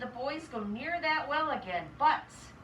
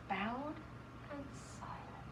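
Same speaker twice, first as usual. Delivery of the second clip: whisper; 12 dB quieter than usual. A narrator's reading. Narrating the silent old man.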